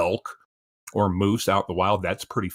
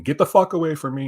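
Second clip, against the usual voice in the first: retold shout, raised and higher; really loud warding-off; 7 semitones higher, 5 dB louder than usual. Man backing away from a mountain lion, retold loud warning. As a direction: re-enact scaring off an animal, voice pitched up. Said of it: "you really loud".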